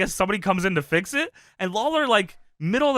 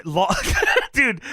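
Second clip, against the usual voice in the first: usual speaking voice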